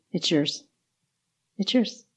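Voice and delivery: soft, hushed voice, spaced out; softly spoken retold assurance; quiet divine prompting. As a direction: gently voice a quiet word from the Lord. God's quiet word retold.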